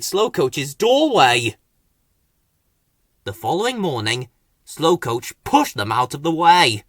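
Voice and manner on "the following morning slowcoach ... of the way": ordinary delivery, nothing marked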